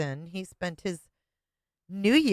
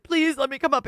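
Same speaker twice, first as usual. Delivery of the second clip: mock begging ex; 7 semitones higher, 3 dB louder, faster than usual. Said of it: "Your ex-husband was like".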